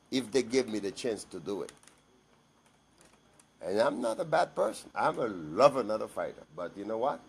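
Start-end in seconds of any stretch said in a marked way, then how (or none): none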